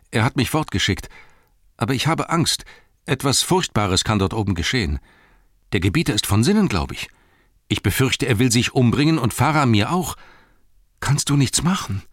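put-on high, worried young-hobbit voice; anxious Pippin, pleading. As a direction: plead fearfully, pitched well up.

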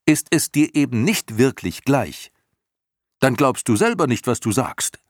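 put-on high, loud, defiant boy; boy loudly calling out the creature's lie. A narrator's reading. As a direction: throw it back at her. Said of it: "rief Atreo".

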